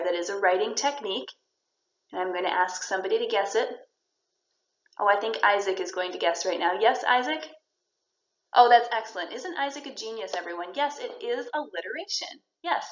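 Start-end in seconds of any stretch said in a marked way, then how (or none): none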